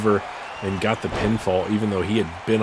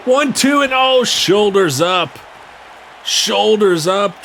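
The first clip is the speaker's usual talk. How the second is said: excited shout; 9 semitones higher, 4 dB louder than usual. Real, not acted. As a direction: yell the count in disbelief. Interference and near fall called.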